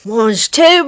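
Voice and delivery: cheeky mock French, rising on tout; a Del Boy from Only Fools and Horses; Del Boy impression. Host doing Del Boy for her cohost, lightly mock French. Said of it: "Little Del Boy reference".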